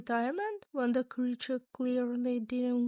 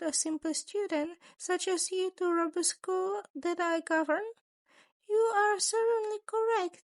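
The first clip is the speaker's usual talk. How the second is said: higher, mock-polite scheming principal voice; a sly creature principal, amused and menacing; 7 semitones higher than usual. A narrator's reading. Principal teasing his student with a sly higher voice. Narrator voices Nezu again.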